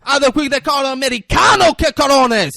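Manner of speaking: high, shouted burst of excitement; hammed-up excited yelling; excited imitation. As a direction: imitate someone getting wildly worked up. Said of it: "Tico gets excited".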